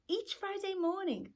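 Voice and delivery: ordinary talk, nothing marked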